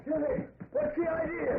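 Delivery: high indignant yell; angry shout. Acted. Hitchhiker catching up and confronting the driver, angry high shout. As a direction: demand an explanation, furious.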